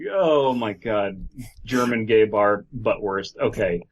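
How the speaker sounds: ordinary delivery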